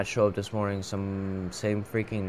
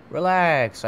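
loud; 6 semitones higher, 7 dB louder than usual. Acted. Player voicing investigator, loud.

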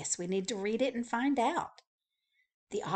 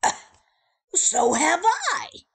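grumbling; 10 semitones higher, 11 dB louder than usual. Acted. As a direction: grumble it, fed up and sleepy. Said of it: "grumbled Mister Snore".

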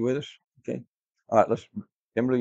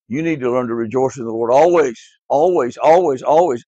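each ALWAYS louder than the one before; building repetition; 4 dB louder than usual. Acted. Teacher urging children to rejoice, ALWAYS rising louder. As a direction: hammer the word always home, growing.

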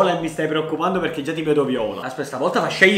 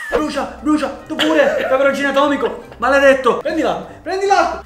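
laughing; 9 semitones higher, 4 dB louder than usual. Real, not acted. Candy burns, laughing taunts.

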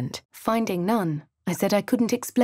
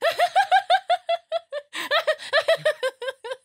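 shrill, gleeful cackle rising high; wild cackle; 3 dB louder than usual. Acted. A voice cackling madly in the dark, wild laugh. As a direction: cackle like a madman savouring it.

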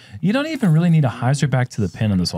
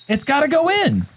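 high, pleading cry at the putt; will the ball in with a raised voice; exasperated high-pitched outburst; desperate exclamation. Real, not acted. Crying out as putt must drop.

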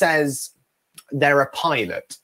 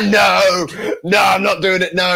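raised, louder mock protest; laughing refusal; 4 semitones higher, 4 dB louder than usual. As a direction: imitate a friend refusing through amusement. Friend laughingly refusing onstage plea.

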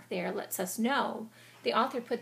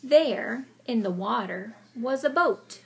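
story narration; 7 dB louder than usual. A narrator's reading. Dramatic read-aloud narration.

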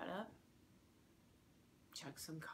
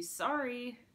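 higher, louder quick sorry; called-out apology; 7 semitones higher, 8 dB louder than usual. A narrator's reading. Runner to angry man before hightailing it out, called-out apology. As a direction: toss out a loud, high apology. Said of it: "I say".

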